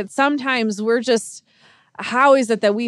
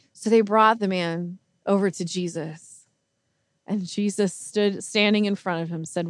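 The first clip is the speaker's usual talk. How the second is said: ordinary delivery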